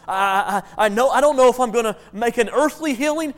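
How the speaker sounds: fervent acted prayer of a sick believer; praying hospital patient. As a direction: cry out to God with firm conviction. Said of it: "A hospital patient can say".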